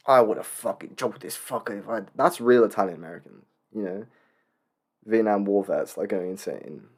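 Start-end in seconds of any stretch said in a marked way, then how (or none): 0.1-2.0 tough New Yorker impression. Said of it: "and he's like".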